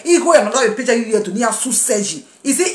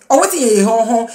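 ordinary delivery

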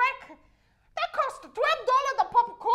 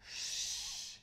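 unvoiced hush; shushing; 15 dB quieter than usual. Lady behind in cinema shushes her, unvoiced. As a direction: hiss a sharp shush. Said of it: "she say".

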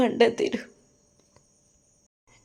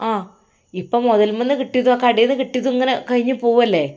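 ordinary talk

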